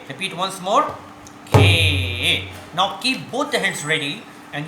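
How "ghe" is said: GHE held long and firmly; drawn-out syllable call. Shown as a demonstration. Teacher demonstrates the stroke, syllable drawn out. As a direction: call out a sustained tabla syllable. Calls syllable for pupils to repeat.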